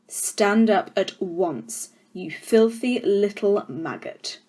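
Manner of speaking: very slow, deliberate threat; the headmistress slowly threatening a pupil; slow menacing headmistress. Acted. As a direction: spell it out with cold menace. Trunchbull menaces Matilda.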